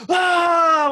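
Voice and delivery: high, loud imitated cheering scream; long excited mock-crowd yell. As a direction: scream like a theater crowd going nuts. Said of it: "like, screaming at it".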